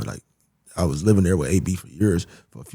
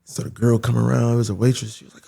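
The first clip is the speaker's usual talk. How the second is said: ordinary talk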